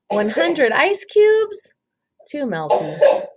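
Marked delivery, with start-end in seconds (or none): 0.1-1.7 put-on high, slow fretful little girl; a small girl fretting aloud; worried girl voice. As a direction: let her voice climb with worry. Jessica weighing ideas, voiced.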